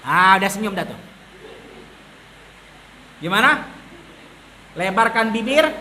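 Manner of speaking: ordinary talk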